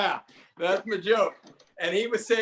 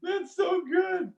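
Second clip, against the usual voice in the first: high, drawn-out delighted wail; slow squeal of appreciation; cracking up; 12 semitones higher, much slower than usual. Real, not acted. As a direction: stretch it out, overcome with amusement. Still cracking up at the joke.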